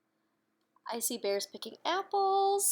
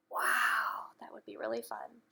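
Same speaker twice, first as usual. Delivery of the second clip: soft whispered aside; 6 semitones lower, 3 dB quieter than usual. Real, not acted. Sharing a secret thrill with the child, whispered. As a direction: breathe it out in a hushed whisper. Aside to listener after story page.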